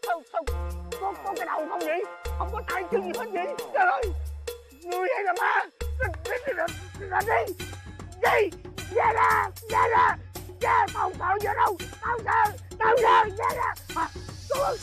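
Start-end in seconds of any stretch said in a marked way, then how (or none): 6.0-13.7 scared bravado. Acted. Facing a ghost, bluffing fear.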